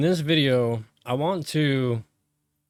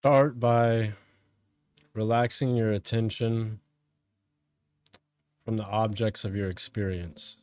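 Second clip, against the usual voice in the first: calm, measured meditation voice with long pauses; guided meditation; 3 semitones lower, 3 dB quieter than usual. Real, not acted. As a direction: guide them inward, phrase by phrase, letting each one settle.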